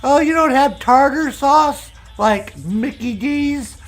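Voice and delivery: very high, slow, loud whine; hammed-up complaining customer voice; mock freaked-out tourist. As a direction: imitate an appalled tourist, drawn out high. Mocking American tourists freaking out.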